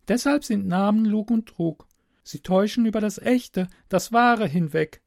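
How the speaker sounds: put-on high girlish voice, lecturing; captive girl, dogmatic. Girl explains names to stranger, high and insistent. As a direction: explain father's creed as a young girl, pitched high.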